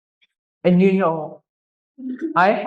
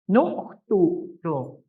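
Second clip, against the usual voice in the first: slow model word; slower than usual. Shown as a demonstration. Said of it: "that noktuto can mean".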